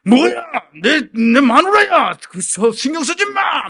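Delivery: high, loud furious snarl; angry retort. Retold or imitated. Old husband snaps at polling staff to leave him alone. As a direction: throw it back at the staff in rage. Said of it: "그러니까".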